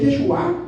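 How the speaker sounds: "Yeshua" with the last A stressed, rising; stress on final A. Shown as a demonstration. Preacher correcting how the church says the name, "Yeshua" stressed on A. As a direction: land the accent on the A of "Yeshua". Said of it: "lleva acento en la A, no en la U".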